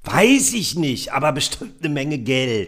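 very high and slow, dismissive mum; high mother voice. Acted. Mother brushes off her son's question, high and slow. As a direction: drag it out high like a vain mother. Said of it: "Du bist Mutter".

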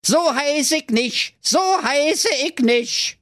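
very high, slow squeaky gloating; gleeful evil doctor. Acted. Doctor rejecting each name, high slow gloating squeak. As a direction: gloat like a cartoon imp. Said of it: "freute sich, wie nur das Böse sich freuen kann".

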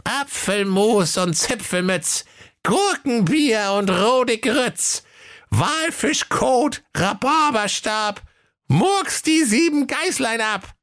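very high, slow, conjuring spell chant; murmured incantation. A narrator's reading. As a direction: chant the curse slowly, spookily. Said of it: "murmelte beschwörend". (langsam beschwörende Zauberformel, sehr hoch)

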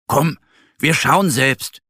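rally your friend bravely; determined bear. A narrator's reading. Bear urging the duck to go look, determined. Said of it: "sagt Mo".

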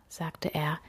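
ordinary delivery

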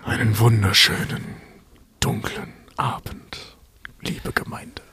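whispered dark sermon greeting; spooky whisper. Acted. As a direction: breathe it like a creepy priest.

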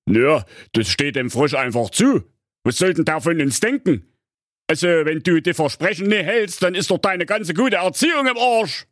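chiding fairy-tale papa; lecturing father. Acted. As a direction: explain her duty to the daughter.

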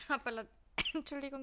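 coughing after swallowing wrong; choking on a drink. Real, not acted. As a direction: cough through the words. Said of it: "ich hab mich grad verschluckt".

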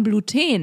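put-on Bluten as blu-TEEN, stress on the long last syllable; Frenchified. Shown as a demonstration. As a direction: say Bluten to rhyme with a French-style glu-TEEN. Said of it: "Das Gleiche ist auch mit Bluten".

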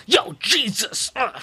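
slow, high, drawn-out "Jesus Christ"; exaggerated American shout. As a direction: ham up a preacher yelling at the camera. Imitating the street preacher Mike.

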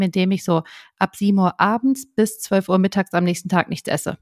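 ordinary talk

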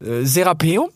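high, hesitant fox voice suggesting the word; high tentative guess; young fox prompting. A narrator's reading. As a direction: offer the word he is looking for. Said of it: "fragte Rix".

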